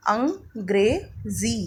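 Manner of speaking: अंग्रेज़ी said slowly with a z sound; careful slow model of अंग्रेज़ी; slow sounded-out word. Shown as a demonstration. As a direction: stretch अंग्रेज़ी, z in ज़ी. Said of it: "जैसे मैंने अभी आपको पढ़ के बताया".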